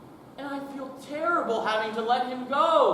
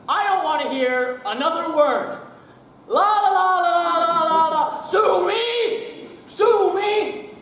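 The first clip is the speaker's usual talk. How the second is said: shouts la la la over him, high and loud; shouting tantrum; 7 semitones higher, 8 dB louder, slower than usual. Acted. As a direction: drown out the news by yelling a song. Boss shouting, covering ears singing.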